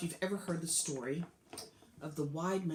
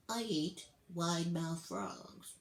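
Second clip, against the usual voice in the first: alligator's answer; slower than usual. Acted.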